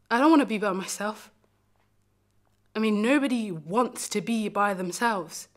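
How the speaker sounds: pleading, indignant self-defence; acted wounded defiance; defiant hurt. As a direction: protest it, pushing the key words. Defends needing the one they loved.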